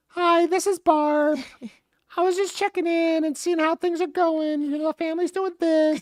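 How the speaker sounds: very high falsetto phone lady; exaggerated sing-song check-in call; Barb. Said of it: "Yeah, Barb".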